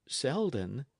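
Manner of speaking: hesitant, rising, left unfinished; a touch of dread before breaking off; faltering. A narrator's reading. Dick failing to break the news, mildly faltering. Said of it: "and he paused again".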